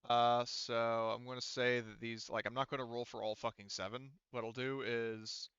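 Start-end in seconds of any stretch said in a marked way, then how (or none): none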